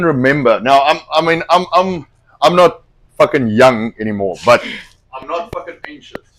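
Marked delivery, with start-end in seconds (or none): none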